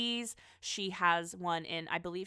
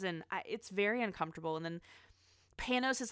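ordinary talk

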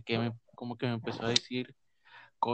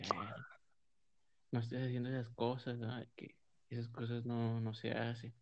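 hushed scolding; 7 dB quieter than usual. Retold or imitated.

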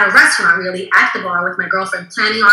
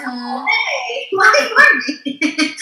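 higher, slow, laughing through a mock-hurt why ignore me; laughing mock complaint; 10 semitones higher, slower than usual. Guest laughing at being ignored by future husband, higher and slow.

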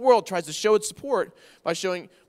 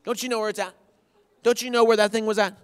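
snippy husband; 4 semitones higher, 4 dB louder than usual. Retold or imitated. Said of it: "I'm like".

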